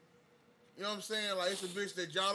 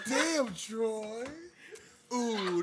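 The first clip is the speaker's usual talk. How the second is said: sultry voice; 4 semitones higher, much slower than usual. Retold or imitated. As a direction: say his name like you're flirting. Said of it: "greet Troy with a sultry voice".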